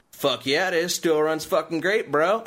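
loud, slow bro drawl; hammed-up gas station dude, loud and slow. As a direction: boast like a proud car bro. Guy at gas station answering.

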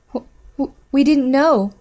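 high, stuttering fright; scared stammer. A narrator's reading. Captive answering the dog handler, scared stammer. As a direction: stumble over the first word in fear.

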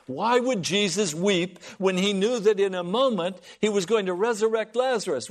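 ordinary delivery

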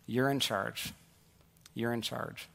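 low, soft, weighty imitation of a coach; hushed, grave retold line; quiet handover. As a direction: hand over command quietly, letting it sink in. Acted coach handing over the playbook.

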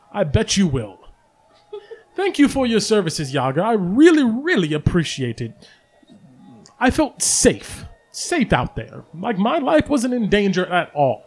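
a hint of sarcastic gratitude, pitch lifted; lightly voiced bard laying on fake thanks; mock-grateful storyteller voice; mildly sarcastic Volo.

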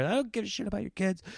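fast, high mock road rage; fierce cursing. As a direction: imitate an irate driver firing back. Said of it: "He's cursing at me".

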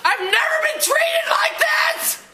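high, tearful shout; upset, high-pitched yelling; outraged protest. Real, not acted. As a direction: cry out in real indignation. Passenger protests to officer.

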